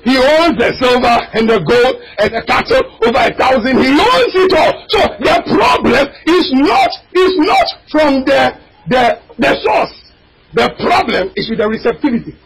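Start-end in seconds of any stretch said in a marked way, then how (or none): 3.8-12.4 fiery preaching. Acted. Preacher crying out to the congregation, fiery. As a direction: preach it out, voice cracking high.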